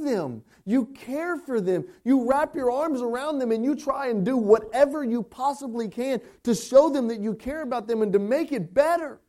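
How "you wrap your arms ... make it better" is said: ordinary talk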